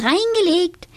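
very high, triumphant repeat; gleeful call. A narrator's reading. Donatella fooling Tobias, gleeful very high cry. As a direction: crow it again with glee. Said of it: "und rief".

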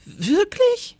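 very high, startled squeak of a question; horrified surprise. A narrator's reading. New teacher learns of constant rain, horrified. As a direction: ask it aghast, pitch leaping up. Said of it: "riss entsetzt die Augen auf".

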